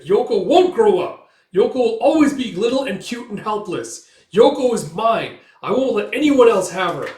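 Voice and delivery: high, loud, vehement insistence; possessive outburst. Retold or imitated. Erica blurting her feelings to Coin, possessive outburst. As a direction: act out losing control and shouting.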